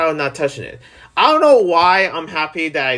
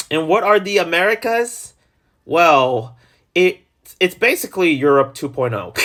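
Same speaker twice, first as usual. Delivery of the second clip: usual speaking voice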